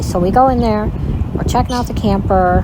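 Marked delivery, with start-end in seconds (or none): none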